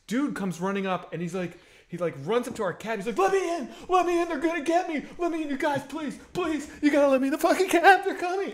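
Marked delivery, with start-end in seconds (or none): 3.2-8.5 high, pleading panic with a laugh breaking through; frantic begging. Retold or imitated. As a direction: beg them to open up before they catch you. Desperate man begging at cab.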